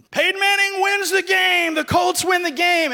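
loud, high play-by-play shout; radio announcer. Retold or imitated. Acting out the radio announcer calling the Colts win.

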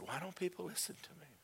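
soft, high, grieving question; pained plea. Retold or imitated. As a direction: plead softly through imagined tears. God asks while weeping.

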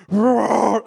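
big, loud growling roar; booming lion impression; imitated lion roar. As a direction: roar hard like an angry lion. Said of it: "the lion goes".

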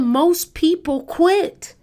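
high, slow, loud declaration; preaching loud. Real, not acted. Preacher drives home the point.

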